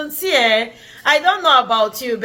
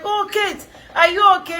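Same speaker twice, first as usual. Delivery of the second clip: lightly acted concern, higher pitch; concerned acquaintance; 7 semitones higher, faster than usual. Church lady asking if she is okay, mock concern. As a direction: imitate a woman checking on her, higher.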